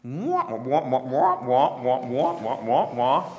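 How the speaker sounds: muted trombone wah wah; lightly voiced wah wah of a cartoon grown-up; Peanuts adult voice. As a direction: mimic the Peanuts teacher's garbled trumpet. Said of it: "hear it like Peanuts adults".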